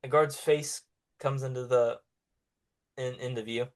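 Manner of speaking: ordinary delivery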